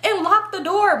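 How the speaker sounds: high, drawn-out indignant yell; retold angry yelling. Cussing about friend who fled, high retold yell. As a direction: re-enact yelling in outrage, stretching the words. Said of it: "yelling, I'm cussing and stuff like that".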